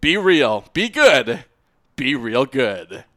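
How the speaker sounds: slow, drawn-out catchphrase; catchphrase sign-off. Acted. Host closing the podcast with the catchphrase, drawn out slowly. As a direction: stretch out the show's tagline.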